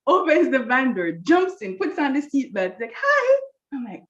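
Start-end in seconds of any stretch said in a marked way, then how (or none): 2.9-3.4 very high, louder mock-chirpy greeting; oblivious greeting. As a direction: sing out a clueless hello, high and loud. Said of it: "totally oblivious".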